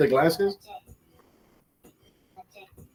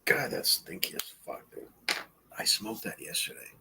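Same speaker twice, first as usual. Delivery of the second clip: whispered aside; 6 semitones lower, 13 dB quieter than usual. Real, not acted. Smelling the joint close up.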